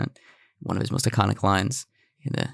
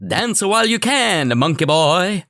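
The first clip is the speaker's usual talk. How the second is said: much higher and louder, slow, with a hammy pseudo-Italian villain voice; Buckaroo Banzai villain; 9 semitones higher, 5 dB louder, slower than usual. Retold or imitated. Host taunting his co-host with the villain's line, hammed up. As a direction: sneer it like a campy alien villain. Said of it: "That was a Buckaroo Banzai reference".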